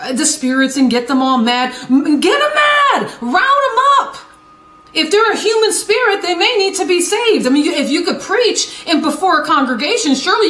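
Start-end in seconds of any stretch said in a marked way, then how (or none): 1.9-4.2 high mocking yell; mock rally cry, much higher; mock shout. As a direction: shout it like rounding up cattle in mockery. Mocking spirit-wranglers, shouting.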